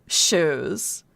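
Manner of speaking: put-on drawn-out Valley Girl parody; Californian meme voice. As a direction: lazily stretch it like a California shopping girl. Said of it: "the most Californian thing that I could say".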